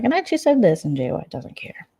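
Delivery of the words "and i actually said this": mocking high voice. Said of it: "Mod one four eight's like".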